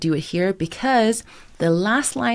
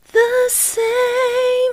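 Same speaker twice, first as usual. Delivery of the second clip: diffused core sound; 4 dB louder than usual. Shown as a demonstration. Coach practicing the diffused note with students. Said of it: "diffused core sound".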